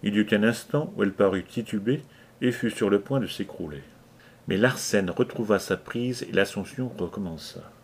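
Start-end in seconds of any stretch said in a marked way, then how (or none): none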